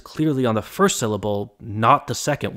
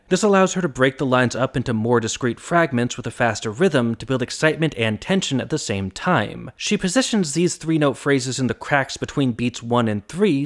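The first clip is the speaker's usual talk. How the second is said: ordinary delivery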